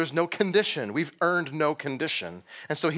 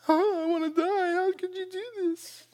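much higher, far-off whine; whiny mocking Jonah. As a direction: parody a self-pitying complainer. Jonah's complaint mocked.

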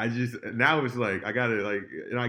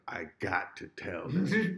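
imitating Jon; 5 semitones lower, 3 dB quieter, slower than usual. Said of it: "the dude was like".

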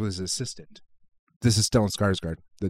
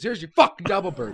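exasperated outburst; mock angry throw; 9 semitones higher than usual. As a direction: snap it out as you give up. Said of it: "and he goes".

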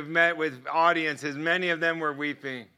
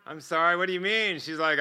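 ordinary talk, nothing marked

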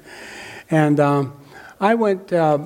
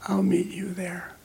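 soft, high, breath-only imitation of a dying man; whisper; 6 semitones higher, 7 dB quieter than usual. Dying man promises to meet his friend, faint whisper. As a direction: whisper it faintly, as if strength is gone. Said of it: "he could barely speak above a whisper".

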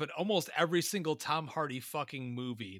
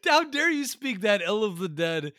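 mock outrage; 2 semitones higher, 7 dB louder than usual. Mock outrage over [name].

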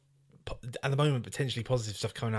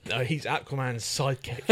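ordinary delivery

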